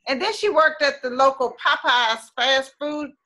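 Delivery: ordinary delivery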